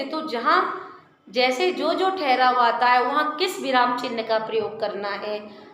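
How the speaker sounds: ordinary delivery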